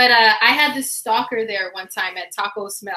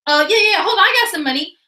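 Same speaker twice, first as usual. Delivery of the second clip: higher, fumbling bum reaching for cash; 7 semitones higher, 4 dB louder, much faster than usual. Retold or imitated. The bum is asked to pay and scrambles, higher and flustered.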